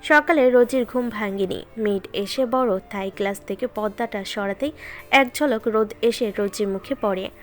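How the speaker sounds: usual speaking voice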